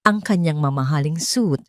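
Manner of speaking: usual speaking voice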